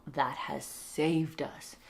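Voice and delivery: slow, earnest whisper. Real, not acted. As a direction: breathe it out slowly. Quiet talk, baby sleeping.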